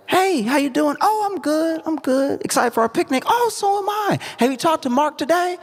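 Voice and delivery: very high mother-daughter phone voices; chirpy phone chat. Acted. Mom calls daughter on speakerphone, chirpy high chat. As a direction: act out a sing-song, high-pitched phone call.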